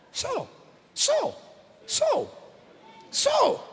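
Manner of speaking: high, soft disbelief on SAW; incredulous echo. Acted. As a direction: repeat SAW in high-pitched amazement. Incredulous echo of scripture word.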